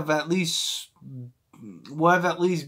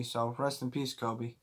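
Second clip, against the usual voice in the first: sincere tribute; 6 semitones lower, 13 dB quieter than usual. Real, not acted. Tribute to Kobe mid-rant.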